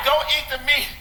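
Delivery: high mock preachy voice, laughing; mocking vegetarian. Comedian mocks vegetarians warning meat eaters, high and laughing. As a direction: parody the vegetarian lecture. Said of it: "They'll say".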